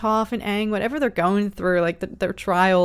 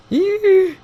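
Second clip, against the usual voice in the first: cheer; 9 semitones higher, 8 dB louder than usual. Real, not acted. Cheering fight on screen.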